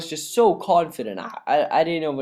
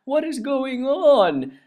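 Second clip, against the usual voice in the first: incredulous; 10 semitones higher, much slower than usual. Real, not acted. Disbelief at goals conceded.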